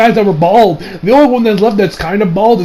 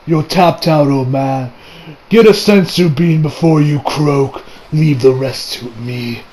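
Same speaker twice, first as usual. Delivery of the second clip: low, slow, quieter character voice; gruff takeover; 6 semitones lower, 3 dB quieter, slower than usual. Retold or imitated. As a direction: tell the old man to step aside, slowly. Acting Vegeta taking over from Roshi.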